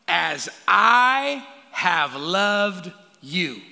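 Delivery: very slow, loud drawn-out words; slow, weighty Jesus quote; emphatic. Acted. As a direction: lean hard on every word. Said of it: "and here it is".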